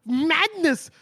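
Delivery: high, hammed-up cry of outrage; over-the-top indignant outburst; outraged mock shout. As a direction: exclaim it loudly, imitating an incredulous man. Acting out character's outraged outburst.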